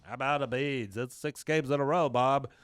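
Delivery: very nasal, stuffed-up voice; nasal retold boy. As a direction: talk as if your nose is blocked. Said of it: "in a very nasally tone".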